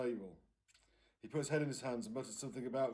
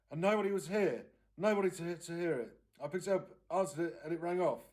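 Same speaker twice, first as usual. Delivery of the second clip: upset aunt, higher and louder, rushing; distraught aunt; 5 semitones higher, 6 dB louder than usual. Acted. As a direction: pour it out, on the edge of tears. Aunt Gloria upset retelling call.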